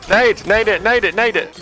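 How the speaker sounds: loud, high urgent calling; grenade warning yell. Real, not acted. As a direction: urge them to throw it now. Urging player to throw grenade.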